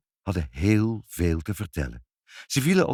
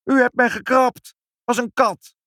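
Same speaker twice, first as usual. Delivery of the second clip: very high, loud indignant complaint; outraged cry; 8 dB louder than usual. A narrator's reading. Inspector accuses mother of scratching him, high outraged cry. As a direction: shriek the accusation.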